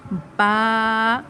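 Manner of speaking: با held one alif length; Arabic ba. Shown as a demonstration. Teacher models the letter slowly. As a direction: say با with the lips closing. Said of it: "کیسے پڑھنا ہے".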